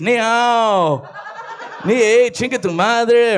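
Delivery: mock Chinese accent, slowed and laughing; fake Chinese. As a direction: fake Chinese mixed with Spanish curse. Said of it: "they pretend to speak Chinese".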